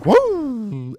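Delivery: high, loud whoop; excited woo. Real, not acted. Vlogger whooping after the clip. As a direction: let out a happy whoop.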